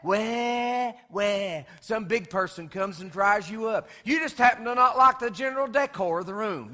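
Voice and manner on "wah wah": put-on baby crying. Baby crying when wet.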